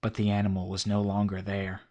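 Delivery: ordinary delivery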